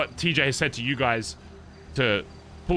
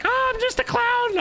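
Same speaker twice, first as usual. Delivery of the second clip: much higher, slow, goofy clown voice; stupid clown voice. Retold or imitated. Lying on the ground, fooling captors with a much higher silly clown voice. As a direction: play the dumbest clown you can. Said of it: "the stupidest clown voice you've ever heard".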